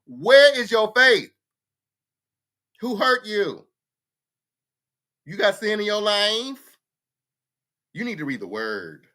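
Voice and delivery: hammed-up scolding like a pushy churchgoer; mocking preachy church folks. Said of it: "That's what folks was telling me".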